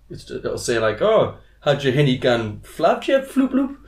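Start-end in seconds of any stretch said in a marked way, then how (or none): 1.0-3.8 slow, laughing mock Geordie gibberish. Reading a Geordie joke book line in mock Geordie. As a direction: ham up thick Geordie slang, laughing. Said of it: "hefty Geordie colloquialisms".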